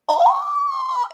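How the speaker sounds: thrilled whoop; excited shout. Real, not acted. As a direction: yell it like the best twist ever. Reacting to a hero appearing.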